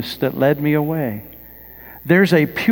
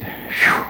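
breathy relief sigh. Acted. Relief after washing feet.

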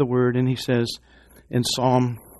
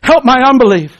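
louder, higher cry of belief; cried out; 11 semitones higher, 8 dB louder than usual. Retold or imitated. Father crying out to Jesus, louder and higher. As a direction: cry it out from the heart. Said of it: "cried out and says".

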